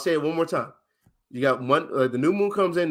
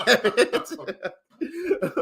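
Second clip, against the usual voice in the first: laughing; 10 semitones higher than usual. Real, not acted. Laughs at own slip.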